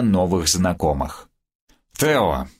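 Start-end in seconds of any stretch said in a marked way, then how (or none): 2.0-2.5 put-on slow, slurred drunken reminder; tongue tangled with drink; drunk slurring. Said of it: "заплетающимся языком напомнил".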